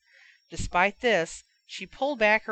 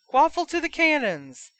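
much higher official call; referee announcing; 9 semitones higher, 4 dB louder than usual. Acted. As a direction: ring out the ruling. Referee awards quaffle.

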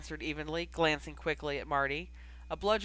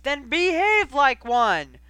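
much higher and louder, slow disgusted yell; disgusted shout; 7 semitones higher, 12 dB louder, slower than usual. A narrator's reading. Coach Oliver ending his rant at Knight, disgusted shout. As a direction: spit the final order at her. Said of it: "shook his head in disgust".

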